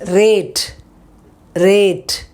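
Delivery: rate with a clear long ay sound; pronunciation demo. Shown as a demonstration. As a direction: drill the word rate, said twice. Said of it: "Now you saw the sounds".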